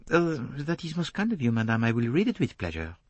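a polite Belgian detective asking; polite French accent. A narrator's reading. Poirot voiced by the narrator.

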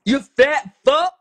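slow, very loud, high-pitched cursing; angry shouting. Real, not acted. Ordering someone up onto the call, angry shouting. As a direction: shout the insult with drawn-out words.

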